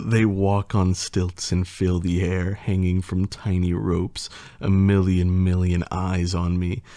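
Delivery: ordinary delivery, nothing marked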